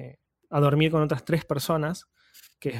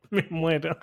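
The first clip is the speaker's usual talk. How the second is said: laughing; 2 semitones higher, slower than usual. Real, not acted. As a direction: laugh through "me muero". Reacting to two beds for four.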